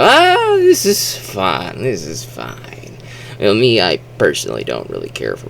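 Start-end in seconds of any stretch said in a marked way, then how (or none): 0.0-2.8 mindless popcorn enjoyment. Acted. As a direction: act the contented, switched-off moviegoer. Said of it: "and you go".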